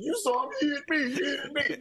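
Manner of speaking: high, squeaky whine through a laugh; mocking whiny Magruder. Mocking Magruder, high squeaky crybaby whine. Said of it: "He's like".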